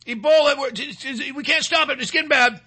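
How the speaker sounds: high, whiny panicked imitation; mock-panicked, high-pitched fretting; mock alarmist official. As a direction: wail it like a hammed-up doom-monger. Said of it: "He said".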